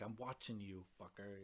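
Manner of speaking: soft, low menacing threat. Acted. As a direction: hiss it as a warning.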